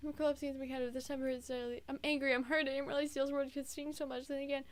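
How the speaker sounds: soft, hushed inner monologue; subdued story narration. A narrator's reading. Reading the heroine's hurt thoughts, subdued soft narration. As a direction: read it quietly, as if hurt.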